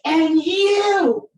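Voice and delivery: put-on high, loud accusing teen; accusing cry. Daughter turns on her mother, accusing loudly. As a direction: throw the blame at mum. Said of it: "she's like".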